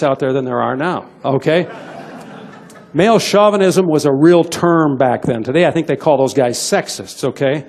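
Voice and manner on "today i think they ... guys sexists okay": usual speaking voice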